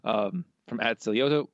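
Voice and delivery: ordinary talk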